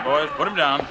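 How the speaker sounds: normal talking voice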